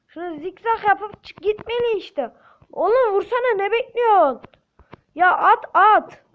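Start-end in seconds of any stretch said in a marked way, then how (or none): 2.7-6.2 high, loud, urgent yelling; shouting at teammates. Real, not acted. Gamer shouting at teammates to shoot, loud and high. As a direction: bark orders to shoot now.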